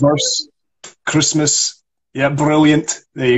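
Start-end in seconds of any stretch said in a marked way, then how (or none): 0.0-2.3 VERSE and CHRISTMAS with rolled R's; Scottish rolled R in VERSE, CHRISTMAS. Shown as a demonstration. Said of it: "we really roll the, the R's". 2.3-3.0 BRILLIANT with a trilled R after the B; Scottish BRILLIANT, rolling R; rolled R. Shown as a demonstration. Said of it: "we really roll the, the R's".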